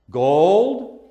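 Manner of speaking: normal talking voice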